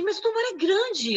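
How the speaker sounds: mild mock outrage, pitch up; retold angry father. Father upset with his daughter over the scan, lightly voiced indignation. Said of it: "ele ficou bravo comigo e falou assim".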